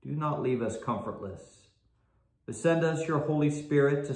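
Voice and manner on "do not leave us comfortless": usual speaking voice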